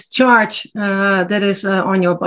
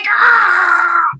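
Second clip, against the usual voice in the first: raging scream; 8 semitones higher than usual. Shown as a demonstration. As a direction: let out a raw scream of pent-up rage. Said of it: "I want to scream".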